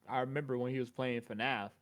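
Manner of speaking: ordinary talk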